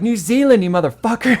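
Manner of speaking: loud, high indignant correction; imitated angry outburst. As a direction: snap back a correction as the offended friend. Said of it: "went off on me".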